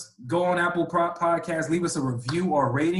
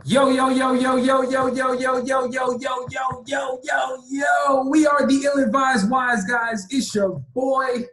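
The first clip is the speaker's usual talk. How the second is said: hype intro; 7 semitones higher, slower than usual. Acted. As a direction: pump up the crowd. Hyped show opener chant.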